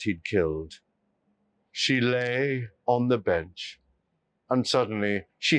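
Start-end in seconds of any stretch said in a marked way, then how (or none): none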